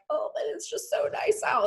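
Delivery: longing inner voice, lifted high; high inner-voice quote. Retold or imitated. To herself while stuck indoors, high wistful sigh. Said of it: "I'm like".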